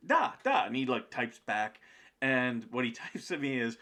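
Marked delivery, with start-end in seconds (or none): 0.1-0.6 higher, louder eager da; eager yes. Retold or imitated. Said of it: "he's like".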